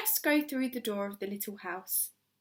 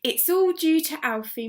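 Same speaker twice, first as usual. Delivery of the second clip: slightly breathless; 6 semitones higher, 7 dB louder than usual. A narrator's reading. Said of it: "said slightly breathlessly".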